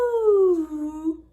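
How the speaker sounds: high ooh gliding down; high-to-low slide. Shown as a demonstration. Teacher demonstrating mountain shape, sung ooh. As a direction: trace a falling shape with the voice. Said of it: "start with our high voice".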